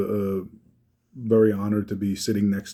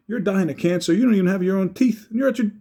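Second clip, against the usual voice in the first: high, loud exasperated yell, building; angry outburst; 12 semitones higher, 3 dB louder than usual. Retold or imitated. Friend scolds fighting brothers at wedding, exasperated yelling. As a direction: let the anger boil over. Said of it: "finally, I get pissed".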